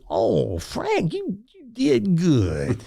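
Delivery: put-on high, slow, touched old man; slow it down, a proud, moved old man; Reggie's voice. Reggie tells the host he did good, high and slow. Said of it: "And in his voice".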